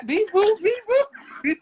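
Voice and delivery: loud, high alien beeps, half laughing; mock alien noises. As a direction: squeal silly alien beeps through giggles.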